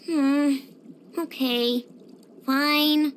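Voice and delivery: low, disappointed agreement; reluctant giving in. Acted. Friend leaving instead of playing, disappointed giving in. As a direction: give in slowly with a pout.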